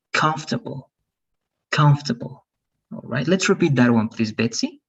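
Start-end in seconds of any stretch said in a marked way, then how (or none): 0.1-2.4 Comfortable as KANF-tabol, middle vowel dropped; stress on first syllable. Shown as a demonstration. Teacher models stress for Betsy, vowel vanishing. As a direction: stress COM, swallow the o r. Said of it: "el estrés va a estar acá".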